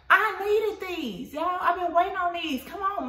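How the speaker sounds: thrilled her order finally came; excited. Real, not acted. Vlogger to viewers, excited that her package arrived.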